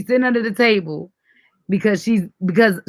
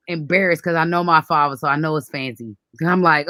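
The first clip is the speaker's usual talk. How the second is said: normal talking voice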